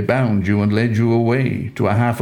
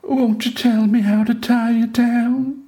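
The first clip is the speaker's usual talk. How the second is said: much higher, coaxing falsetto Delilah; sweet hopeful temptress voice, pitched up high; Delilah pleading; 13 semitones higher than usual. A narrator's reading. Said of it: "wore her sweetest and most hopeful frown".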